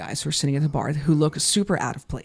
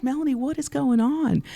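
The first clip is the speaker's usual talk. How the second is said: put-on concerned girlfriend; 9 semitones higher than usual. Girlfriend comes over, concerned.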